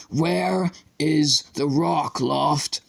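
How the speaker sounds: slow, pleading menace in a put-on voice; unhinged teacher grabbing a boy, slowly; desperate, half-mad Flowerdew. A narrator's reading. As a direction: lean in, drag each word out desperately. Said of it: "He looked desperate, almost mad".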